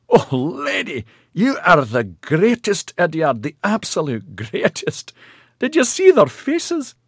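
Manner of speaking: high, loud delighted gushing; gleeful. A narrator's reading. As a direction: crow with glee. Maxon laughing in delight.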